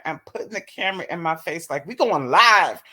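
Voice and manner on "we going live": mock hype man. Man filming her on a date.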